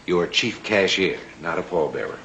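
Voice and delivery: normal talking voice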